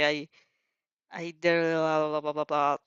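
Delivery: ordinary talk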